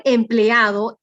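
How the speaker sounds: slow, loud EMPLEADO with E and A held apart; hiatus shown. Shown as a demonstration. Teacher showing students the hiatus, empleado slowly split at e-a. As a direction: say empleado slowly, pulling the e away from the a. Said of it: "tienen que separarse aquí".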